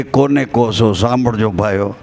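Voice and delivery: a guru's pointed low question. Retold or imitated. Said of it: "મને કે".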